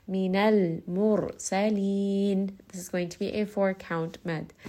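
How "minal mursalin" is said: Minal mursalin very slow, resting on the lam and the ra; resting on letters. Shown as a demonstration. Teacher showing students how to rest on tawassut letters, very slow. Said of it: "rest on all of these letters of tawassut".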